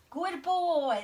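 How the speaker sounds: excited praise. Real, not acted.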